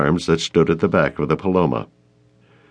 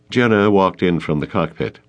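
ordinary delivery, nothing marked

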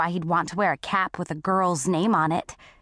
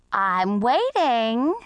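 slow, swooping singsong call; old lady calling out; 3 semitones higher, much slower than usual. A narrator's reading. Old lady goads the bully from below, drawn-out call. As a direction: stretch it out, lilting up at the end. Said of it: "the old lady called out".

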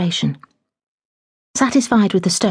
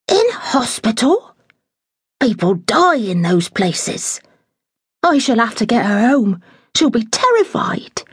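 lightly voiced flustered housekeeper; a hint of a fussing older woman in a fluster; fretful housekeeper; 5 semitones higher than usual. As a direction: housekeeper resolving to fetch her mother, flustered.

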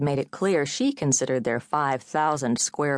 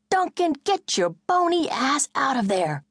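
high, slow yell through the door; 12 semitones higher, 3 dB louder than usual. A narrator's reading. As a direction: bang on the door and yell him out. Banging on bathroom door, yelling.

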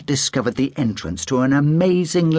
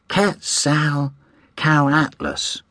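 Quetzalcoatlus sounded out very slowly; slow careful reading; 2 semitones higher, much slower than usual. A narrator's reading. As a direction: spell out Quetzalcoatlus syllable by syllable. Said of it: "Jamie read".

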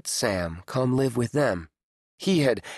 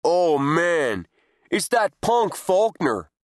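sneering bully, higher and slower; cheeky voice from trash can; 4 semitones higher, 3 dB louder, slower than usual. Acted. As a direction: drawl out the insult with contempt. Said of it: "A talking garbage can?".

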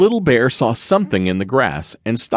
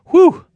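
high loud exhausted puff of relief; a big breathy whew, higher and louder; tired whew; 7 dB louder than usual. Acted. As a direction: blow out hard, like you just made it. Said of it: "I'm so tired".

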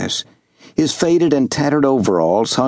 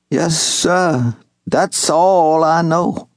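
higher, lightly voiced old Southern servant; old servant calling out; 4 semitones higher than usual. Old servant Wash hailing young Master Jubal, lightly voiced. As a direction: call across the clearing in a gentle old man's voice.

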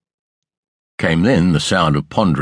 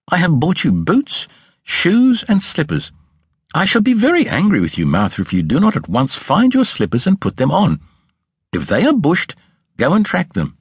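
high, firm scolding voice, lightly acted; a mistress laying down the law; stern mistress; 11 semitones higher than usual. A narrator's reading. Mrs Thornton ordering Martha to find her slippers, stern.

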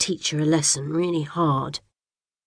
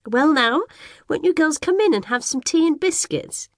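put-on high, fluttery old lady voice; fussy neighbour; 11 semitones higher than usual. A narrator's reading. Old neighbour greeting the twins in a high, gushing voice. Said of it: "like she always does".

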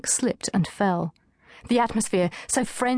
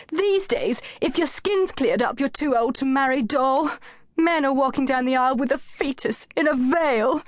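whining lament; 6 semitones higher than usual. A narrator's reading. Anushka lamenting her marriage chances, higher whine. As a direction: wail about getting old. Anushka's wailing self-pity.

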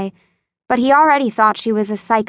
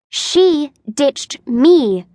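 defensive protest; 5 semitones higher, much slower than usual. A narrator's reading. Daughter protesting to her father that her friend ditched her. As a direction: push back hard, hurt and indignant. Joy defending herself, upset.

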